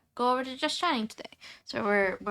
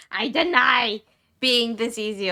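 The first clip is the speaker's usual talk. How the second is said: mock denial; 2 semitones higher, 6 dB louder, slower than usual. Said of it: "I'm in denial".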